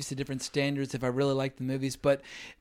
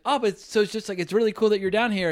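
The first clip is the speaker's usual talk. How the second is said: tag; 5 semitones higher, 5 dB louder than usual. Retold or imitated. Retelling keen praise, met coolly.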